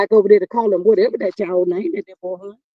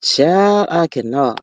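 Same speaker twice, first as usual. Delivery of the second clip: ordinary talk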